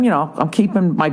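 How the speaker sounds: normal talking voice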